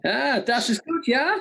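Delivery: playful mock German accent. Quiz host playing at German with his co-host, German accent. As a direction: put on a jokey German accent. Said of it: "I'm learning more accents".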